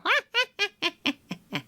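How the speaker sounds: high, descending staccato cackle; put-on wicked cackle; evil cackle. Shown as a demonstration. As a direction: cackle like a gloating villain, high and twangy. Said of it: "Another one is cackling".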